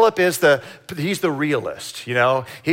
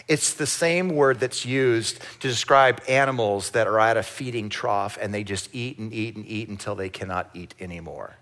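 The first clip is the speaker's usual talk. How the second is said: normal talking voice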